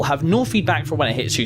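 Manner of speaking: normal talking voice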